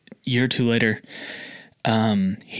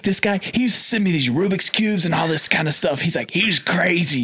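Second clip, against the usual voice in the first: high, incredulous mock exclamation; animated storytelling; 7 semitones higher than usual. Big customer telling a stranger about crazy mailed gifts, excited and high. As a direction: act out a customer marveling to a stranger.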